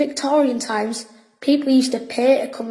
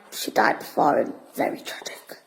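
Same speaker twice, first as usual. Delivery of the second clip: spooky whisper; 10 semitones lower, 6 dB quieter than usual. Acted. Boy tells his friend a ghost story in the cave, whispered.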